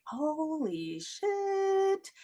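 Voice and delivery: very slow, stretched-out amazement; hushed, drawn-out astonishment; awed realization. Retold or imitated.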